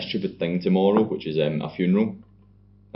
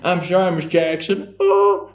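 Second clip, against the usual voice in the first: high, slow put-on lovestruck voice; mock smitten Adam; 11 semitones higher, 5 dB louder, slower than usual. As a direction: ham up the smooth-talking suitor. Adam voiced courting her.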